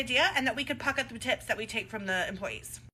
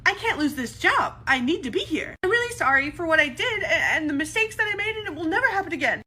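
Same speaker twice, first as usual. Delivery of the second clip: loud, high, teary pleading; crocodile tears; 7 semitones higher, 6 dB louder than usual. Acted. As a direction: beg loudly like fake crying. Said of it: "your manipulative little crocodile tear".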